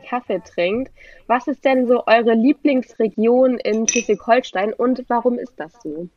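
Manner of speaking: ordinary talk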